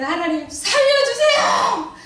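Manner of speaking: high, loud, slow cry to God; panicked prayer stretched out slowly; desperate plea. Retold or imitated. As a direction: beg God to save him, terrified. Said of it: "절박한 심정으로 기도했어요".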